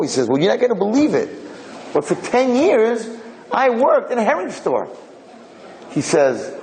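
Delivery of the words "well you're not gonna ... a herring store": high and eager, slowing at the end; can't believe his luck, slowing down to savor it; excited reveal. Acted. Poor man tells his host, excited and slowed.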